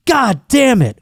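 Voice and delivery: high-pitched exasperated outburst; mock frustrated curse. Pitcher who thinks his mic is off, mock-frustrated cursing.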